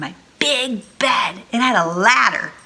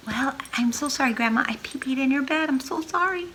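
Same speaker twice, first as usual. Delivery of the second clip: soft, higher sheepish apology; small sorry voice; 6 semitones higher, 5 dB quieter than usual. Acted. Sarah confessing to grandma, soft and sheepish. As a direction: apologize quietly like a sheepish child.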